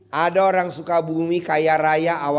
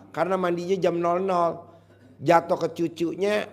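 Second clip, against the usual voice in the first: ordinary talk, nothing marked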